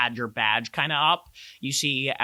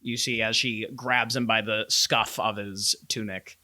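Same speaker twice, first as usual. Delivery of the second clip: ordinary talk, nothing marked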